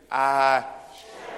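"I" loud and drawn out, leading into SHALL; stress on SHALL. Shown as a demonstration. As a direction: lead the crowd loudly, leaning toward SHALL. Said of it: "Put the emphasis on shall".

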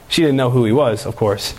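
ordinary delivery

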